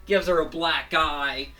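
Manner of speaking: ordinary talk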